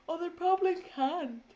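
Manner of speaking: words stretched by a yawn. Real, not acted. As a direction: talk through a yawn. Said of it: "Sorry, yawning".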